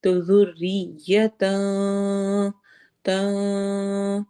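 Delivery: ذریتاً stretched very slowly, ending nun hidden in the nose; slow recitation, تاں nasal and hidden. Shown as a demonstration. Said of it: "آواز کو ہم نے چھپا دینا ہے".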